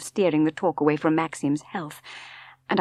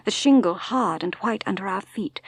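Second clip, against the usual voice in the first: ordinary delivery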